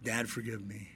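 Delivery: soft, low, broken whisper of a plea; a hushed crying daughter; tearful plea. Retold or imitated. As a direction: beg forgiveness under the breath.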